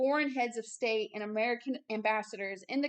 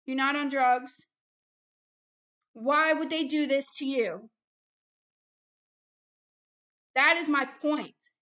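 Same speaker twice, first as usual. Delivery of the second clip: heated, loud rebuke; worked-up, forceful challenge to the listener; mad; 3 semitones higher, 6 dB louder than usual. Real, not acted. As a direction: press each point hard, loud and raised in pitch. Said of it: "I'm mad".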